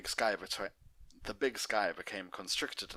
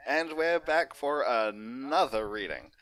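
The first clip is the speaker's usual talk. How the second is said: bright, upbeat pony host; cheery character host greeting; Doctor Hooves voice; 6 semitones higher, 10 dB louder, slower than usual. Acted.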